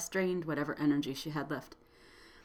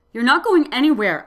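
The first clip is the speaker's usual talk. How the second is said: loud, firm refusal; insist, raising the voice; firm insistent; 6 semitones higher, 14 dB louder than usual. A narrator's reading. Narrator insists to injured woman.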